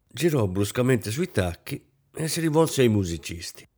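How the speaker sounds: usual speaking voice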